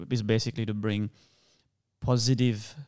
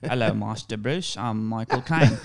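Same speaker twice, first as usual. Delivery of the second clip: slow, hammed-up Cockney butler accent; drawn-out imitated Cockney, slipping toward Australian; Michael Caine; 6 dB louder, slower than usual. Guest trying his impression for the hosts, slow Cockney accent. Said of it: "Was that a good Michael Caine?".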